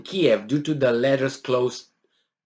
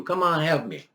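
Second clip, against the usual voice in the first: ordinary delivery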